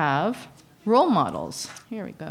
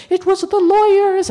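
high, loud mimicked excuse; mock social worker; 14 semitones higher, 8 dB louder than usual. Social worker passing blame to lawyers, mocked high and loud. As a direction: squeal it out in a much higher, louder voice. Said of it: "And the social worker's going".

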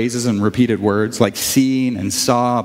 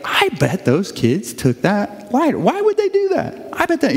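mock suspicious; 8 semitones higher than usual. Dad suspects kids took lighter.

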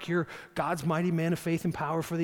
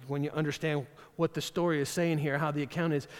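ordinary delivery

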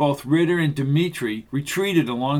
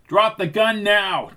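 higher, slow firm command; demanding; 6 semitones higher, 3 dB louder than usual. A narrator's reading. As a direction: order him to drop it. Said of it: "demanded Jansen".

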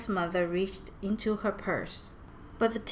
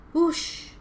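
high imitated whoosh; storybook sound effect of a whoosh; 10 semitones higher, 4 dB louder than usual. A narrator's reading. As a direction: let out a quick high "whoosh". Narrator imitates a whooshing noise.

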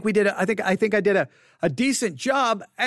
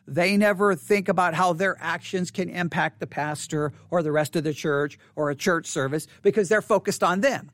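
ordinary talk

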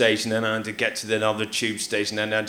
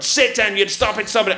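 mock scolding; 9 semitones higher, 3 dB louder than usual. Imitating people telling her off.

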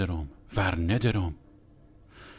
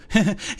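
imitated giggle; 7 semitones higher than usual. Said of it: "با همون لهجهٔ تهرانی شخمیش گفت".

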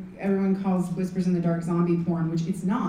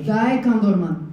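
put-on Russian accent; 4 dB louder, slower than usual. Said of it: "do your Russian accent for me".

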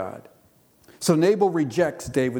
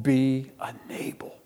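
whispered warning. Acted. Preacher's warning to congregation.

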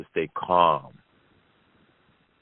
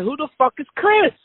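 much higher, loud shocked yell; high-pitched indignant outburst; outraged shout; 5 dB louder than usual. Retold or imitated. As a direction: blurt it out in shocked disbelief. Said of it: "I said".

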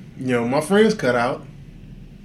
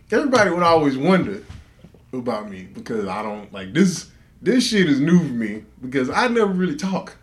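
ordinary talk, nothing marked

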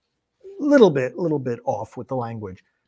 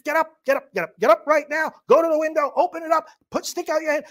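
high-pitched, agitated commands; urgent movie orders; 13 semitones higher, 5 dB louder, faster than usual. Acted. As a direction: rouse everyone out of their seats. Acting the film character's urgent orders.

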